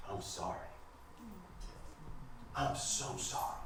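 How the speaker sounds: whispered, barely voiced sorry; hushed apology. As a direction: breathe the apology out softly.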